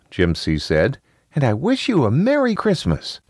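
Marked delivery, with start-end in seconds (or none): 1.3-3.2 put-on high-pitched little kid; high child voice, politely. A narrator's reading. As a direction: speak like a well-mannered young child.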